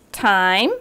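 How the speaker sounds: normal talking voice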